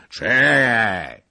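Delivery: put-on loud stubborn drunken wait; drunken stubbornness. A narrator's reading. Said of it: "z uporem pijanego człowieka".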